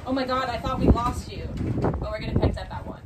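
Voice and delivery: loud excited message. Retold or imitated. Retold text, loud and slow.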